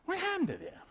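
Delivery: astonished onlookers asking; mock bafflement. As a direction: people puzzled at a changed friend.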